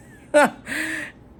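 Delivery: a high loud burst of laughter; real laugh. Real, not acted. Complaining about people who double text her, loud high laugh.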